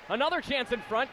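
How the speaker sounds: normal talking voice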